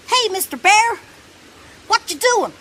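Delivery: very high, loud cartoon rabbit. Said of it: "this rabbit comes flying up, and he goes".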